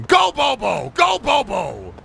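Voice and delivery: high-pitched repeated chant yell; playful yelling. Pretend. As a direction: yell it like a mad fan. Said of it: "I'm just yelling".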